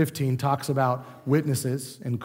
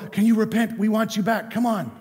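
high, coaxing plea to come back; pleading call; 6 semitones higher than usual. Retold or imitated. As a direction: beg him home, pitched up. Church calling wayward one back.

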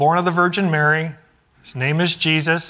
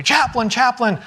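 calling out; 7 semitones higher than usual. Retold or imitated. Marine running up calling out.

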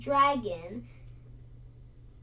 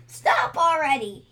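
louder, higher plea; pleading cry; 4 semitones higher, 9 dB louder than usual. A narrator's reading. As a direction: cry out to make her stop. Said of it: "he cried".

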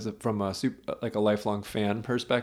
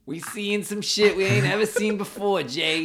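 high, put-on Boston accent; 8 semitones higher than usual. Telling Jay what they're seeing, in a Boston accent. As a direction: pitch it up in a mock Boston voice. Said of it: "let's do a Boston".

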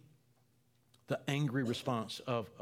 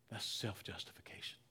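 "self-justification" whispered, much softer; 4 semitones lower, 10 dB quieter than usual. Shown as a demonstration. Preacher repeating the word to the church, whispered. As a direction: breathe "That's self-justification" with no voice.

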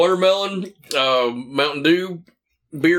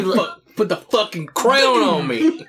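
hammed-up wrestler demand; 7 semitones higher than usual. Playing Mantar demanding his crown, hammed-up. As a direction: draw it out as a pretend monster king.

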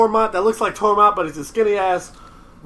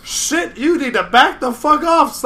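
raised pitch, incredulous challenge; mock alarmed warning; 6 semitones higher, slower than usual. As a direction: warn someone off in a retold quote. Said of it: "Jon Snow's like".

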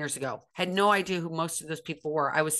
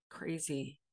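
muttered; 6 semitones lower, 12 dB quieter than usual. Real, not acted. Muttered repeat of crazy.

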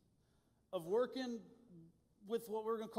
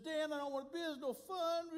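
higher, whiny plea; mock-whine like a fed-up kid; whining; 7 semitones higher than usual. Young camper whining to his leader Dan in the canoe, higher mock whine. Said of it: "whining to Dan so much".

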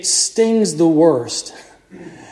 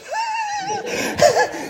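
short high laugh at his own joke; amused preacher laughing; high burst of laughter; 6 dB quieter than usual. Real, not acted. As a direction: laugh it off brightly.

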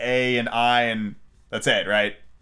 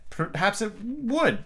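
high, slow and hesitant; cautious reply; 10 semitones higher, much slower than usual. A narrator's reading. Alice answering the Red Queen, cautious. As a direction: venture it cautiously, drawn out. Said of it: "Alice replied cautiously".

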